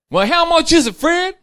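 high, loud testing question; wife testing her husband, pitched high; suspicious wife. Acted. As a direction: catch him out as the wife.

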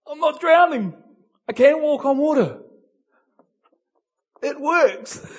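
loud, high, amazed cry; excited disbelief. Acted. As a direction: shout it like you can't believe you're walking. Peter's voice on water.

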